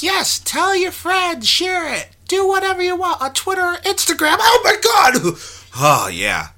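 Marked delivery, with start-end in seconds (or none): 0.0-5.4 put-on high squeaky child's voice; kid voice. Podcaster stuck in his kid voice after saying Twitter, high and squeaky. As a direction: pitch way up like a little kid. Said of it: "this is my kid voice".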